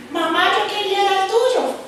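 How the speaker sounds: put-on high, slow, whiny kid voice; whining child. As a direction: drag it out like a begging child.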